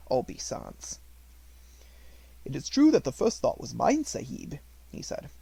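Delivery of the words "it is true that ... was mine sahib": devoted servant Ramdas. A narrator's reading. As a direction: speak up gently for the child you watch over. Ramdas explains.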